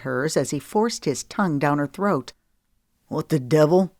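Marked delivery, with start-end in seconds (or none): 3.1-3.9 a touch of indignant shock; lightly voiced angry man. Boss catching man on top of woman, stern. Cash interrupts.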